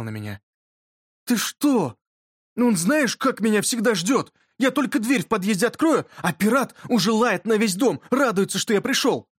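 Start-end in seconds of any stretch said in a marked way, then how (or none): 1.2-9.3 put-on eager, higher-pitched boyish enthusiasm; excited protest. A narrator's reading. Yurka telling friend how his dog waits, excited protest. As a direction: gush about your beloved dog.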